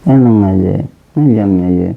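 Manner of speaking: very low, loud drawn-out plea; coaxing plea. Real, not acted. Son begs mother not to sulk, coaxing plea. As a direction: win back a sulking parent.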